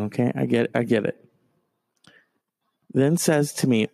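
usual speaking voice

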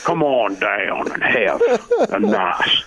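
slow announcer read; slow, drawn-out announcer impression; imitating Lloyd. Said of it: "the way Lloyd reads that".

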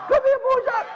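high sing-song call across the yard; calling the dog. Retold or imitated. Jogging past the house, calling the dog over, high call.

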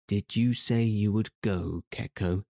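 plain reading voice

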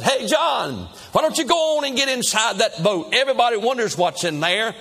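high, hearty call across a crowd; urging shout to someone across the way; crying out. Acted. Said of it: "and he cries out and said".

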